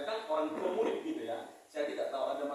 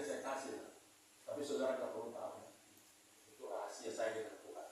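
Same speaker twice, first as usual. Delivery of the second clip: ordinary talk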